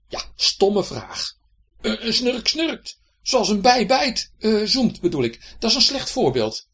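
high, slow flustered snore; huffy little snore; indignant snore. A narrator's reading. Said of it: "riep de snurk". (hoog en verontwaardigd snurkje)

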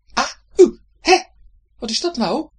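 loud, high startled yelp; startled cry. A narrator's reading. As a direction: cry out at the stinging drops. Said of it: "riep de snurk". (hoog en luid geschrokken)

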